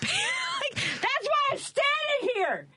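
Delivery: high, slow laughing cry of disbelief; laughing outburst. Real, not acted.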